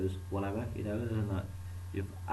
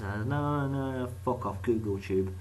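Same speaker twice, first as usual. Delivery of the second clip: usual speaking voice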